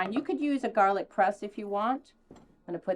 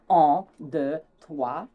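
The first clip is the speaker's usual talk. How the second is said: mock French counting; 2 semitones lower, 5 dB louder, much slower than usual. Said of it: "every French word in my vocabulary".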